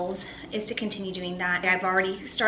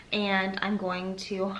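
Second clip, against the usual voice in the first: ordinary talk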